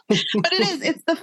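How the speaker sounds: real giggle spilling into speech; laughing. Real, not acted. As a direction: still laughing from the line before. Laughs after getting emotional.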